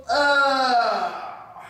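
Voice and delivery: long, high falling "aah"; waking yawn. Acted. Bear opening his eyes in the cave, big acted yawn.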